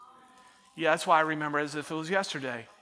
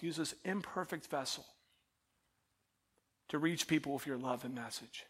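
soft, hushed prayer spoken to God; low earnest prayer voice; quiet prayer; 4 semitones lower, 9 dB quieter than usual. Acted. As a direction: pray it gently and sincerely. Prays aloud.

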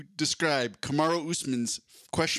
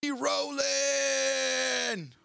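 booming, drawn-out fight-night intro, much higher; ring announcer voice; 12 semitones higher, much slower than usual. Acted. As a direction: stretch every word like a boxing announcer. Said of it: "I'm doing my ring announcer voice".

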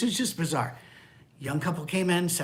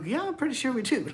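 higher, eager spouse voice; cheery spouse; 10 semitones higher than usual. Retold or imitated. Pastor imitates spouse's sweet agreement, higher. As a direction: answer sweetly to each other. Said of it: "You know".

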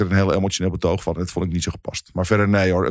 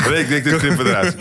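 laughing; 6 semitones higher than usual. Real, not acted. Laughing after the voicemail joke.

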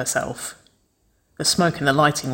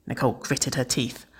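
plain reading voice

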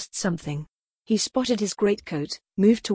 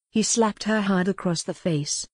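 usual speaking voice

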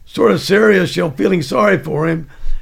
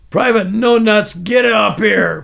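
loud, higher shouted summons; sergeant's shout; 4 semitones higher, 4 dB louder than usual. Retold or imitated. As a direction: yell him up front like a drill instructor. Sergeant calling recruit forward.

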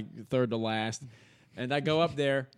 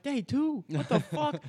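much higher startled squeak of disbelief; stunned disbelief; 12 semitones higher, slower than usual. Retold or imitated. Told to come back a second day, stunned. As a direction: react as if the news is absurd. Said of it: "I was like".

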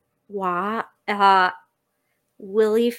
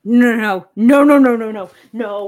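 panicked; 11 dB louder than usual. Acted. Panicked search for missing bag.

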